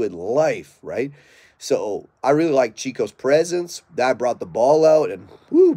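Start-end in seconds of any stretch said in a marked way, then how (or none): 5.5-5.7 a high, loud woo of admiration; sudden high excited woo; excited whoop. Real, not acted. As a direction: whoop it out, impressed by the dog. Excited about the dog's drive.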